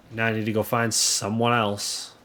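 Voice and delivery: ordinary delivery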